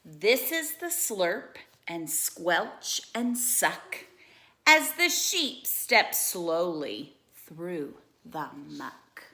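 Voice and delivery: lively storybook read-aloud; animated bedtime-story reading; reading to children. Acted. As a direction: read it like a picture book for kids.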